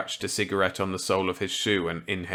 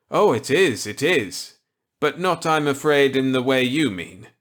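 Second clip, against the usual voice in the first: higher, louder voice for kindly Millet; Millet's voice; 6 semitones higher, 7 dB louder than usual. Acted. Millet offering Walker a gambit, kindly. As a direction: play the genial old scientist warmly.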